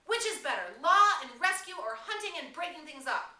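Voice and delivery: high, loud challenge; shout. Acted. Ralph shouting against the tribe's noise. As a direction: shout over the crowd. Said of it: "Ralph shouted against the noise".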